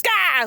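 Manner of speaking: high mock "gah"; exaggerated villain's startle; startled waking yell. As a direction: wake with a cartoonish cry.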